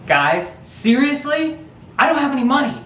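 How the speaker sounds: pleading with the hidden crowd in disbelief; exasperated friend. Retold or imitated. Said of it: "he's like".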